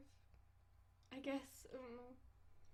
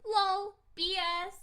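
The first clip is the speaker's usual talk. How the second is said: high, loud scoff; mocking cat girl; 5 semitones higher, 6 dB louder than usual. Cat girl scoffing at his dignified symbols, mocking. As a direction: scoff it like a cheeky kitten.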